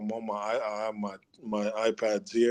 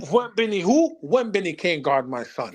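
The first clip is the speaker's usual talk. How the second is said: higher, playful taunt; mock challenge; 6 semitones higher than usual. Real, not acted. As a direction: brush him off with a grin. Hyped boasting about son.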